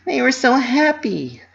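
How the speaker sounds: high, fond inner voice; Pinky's thought. A narrator's reading. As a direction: think it warmly to herself. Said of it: "Pinky thought".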